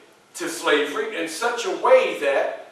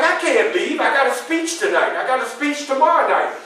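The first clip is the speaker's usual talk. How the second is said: protesting; 7 semitones higher, 4 dB louder than usual. Retold or imitated. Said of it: "And I'm like".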